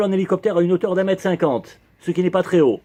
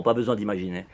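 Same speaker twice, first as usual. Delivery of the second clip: ordinary delivery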